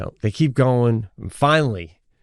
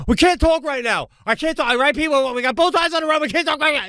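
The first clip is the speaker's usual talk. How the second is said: tense wife voice; faster than usual. Retold or imitated. Family driving in storm.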